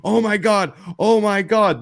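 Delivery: high, loud hammed-up alarm; mock panic. Parent reacts to kid's bumped head, hammed-up panic. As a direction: imitate an overreacting parent.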